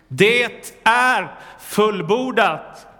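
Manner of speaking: loud, high, very slow proclamation; triumphant cry. Acted. Preacher voicing Jesus' last shout from the cross, loud and slow. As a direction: ring it out slowly like a final victory shout. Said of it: "Han ropar ut".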